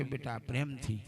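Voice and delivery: put-on a quiet loving word to a child; gently, aside. Shown as a demonstration. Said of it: "એને એક બાજુ લઈ જાઓ કે". (એક બાજુ લઈ જઈ પ્રેમથી, ધીમા અવાજે)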